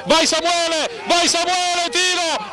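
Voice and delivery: high urgent cheering on; excited urging. Real, not acted. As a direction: urge the striker on. Urging player on, shot.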